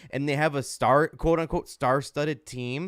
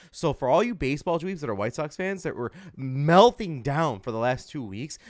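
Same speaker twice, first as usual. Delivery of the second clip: usual speaking voice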